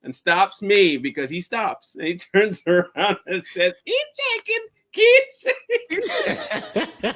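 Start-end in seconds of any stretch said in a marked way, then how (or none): 3.9-7.1 very high squeal breaking into laughter; high-pitched taunt, the last one drawn out; laughing retold taunt. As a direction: crack up while imitating the taunt. Retold taunt, cracking up while telling.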